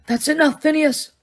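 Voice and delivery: calm rebuke, lightly voiced; Dumbledore, firm. A narrator's reading. Headmaster silencing the portrait, firm and calm. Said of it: "said Dumbledore".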